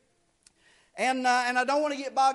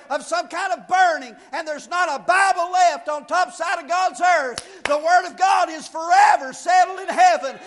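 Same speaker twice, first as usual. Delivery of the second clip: full-voiced, driving pulpit proclamation; fervent preacher in full flow; impassioned preaching; 5 semitones higher, 6 dB louder than usual. Real, not acted. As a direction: preach it hard, punching the key words.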